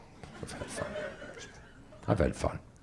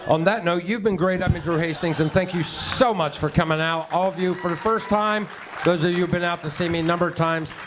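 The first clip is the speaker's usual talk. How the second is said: show sign-off; 12 semitones higher, 4 dB louder than usual. Acted. Closing stage sign-off.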